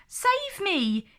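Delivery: high, pleading princess. A narrator's reading. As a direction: beg him to save you. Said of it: "entreated Prudence".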